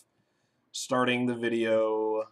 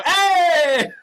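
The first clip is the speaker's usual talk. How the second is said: laughing greeting; 9 dB louder than usual. Real, not acted. Joining the excited greeting.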